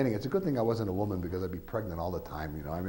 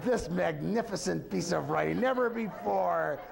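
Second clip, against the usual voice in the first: mock-grandiose, high and slow, savoring each word; grand blurb voice; 8 semitones higher than usual. Author drafting his own blurb, hammed-up high and slow. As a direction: read it like an overblown book-jacket rave.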